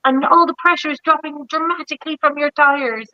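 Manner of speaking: imitating a dashboard alert; mock car warning. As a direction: voice the car's warning message. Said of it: "I'm like".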